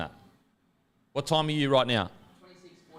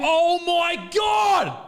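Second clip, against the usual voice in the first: high-pitched shout of disbelief; excited shout; 13 semitones higher, 3 dB louder, much slower than usual. Real, not acted. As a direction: cry out in shock at the play. Reacting live to a rugby match.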